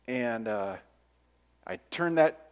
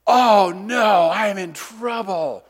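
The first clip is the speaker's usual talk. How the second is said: loud, higher oh no, then slow; dismayed; 5 semitones higher, 9 dB louder, slower than usual. Retold or imitated. Boy watching the crash, loud and dismayed. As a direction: realise you're in big trouble. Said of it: "And I'm like".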